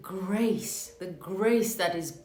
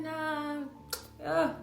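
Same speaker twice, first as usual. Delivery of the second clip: put-on higher, dismissive South African accent; 7 semitones higher, 3 dB quieter than usual. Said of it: "He had this South African accent".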